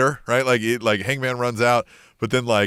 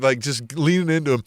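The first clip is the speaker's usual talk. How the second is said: ordinary delivery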